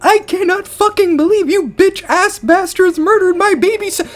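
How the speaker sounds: high, loud, furious shout; furious yell. Acted. Brother screams at his parents, high furious yell. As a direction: yell it at the parents, full rage.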